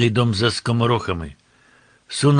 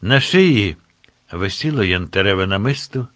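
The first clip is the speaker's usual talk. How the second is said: ordinary delivery, nothing marked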